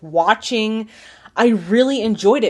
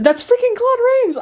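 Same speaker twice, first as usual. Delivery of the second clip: high, thrilled star-struck squeal; freaking out; 14 semitones higher than usual. Real, not acted. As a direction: gush over the actor, pitch way up. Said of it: "I don't know why I'm freaking out".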